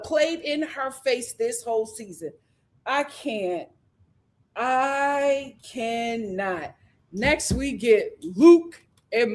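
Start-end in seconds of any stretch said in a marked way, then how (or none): none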